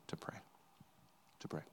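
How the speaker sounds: hushed, breathy urging; whisper. Real, not acted. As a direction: lean in and whisper the plea. Pastor urges congregation to pray.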